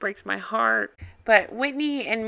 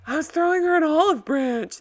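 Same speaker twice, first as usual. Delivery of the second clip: high-pitched put-on impersonation voice; imitating someone's protest, pitched up high; Lisa impersonation; 7 semitones higher than usual. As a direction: mimic her in a raised, put-on voice. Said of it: "That's my Lisa impersonation".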